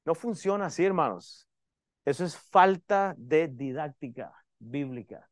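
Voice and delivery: usual speaking voice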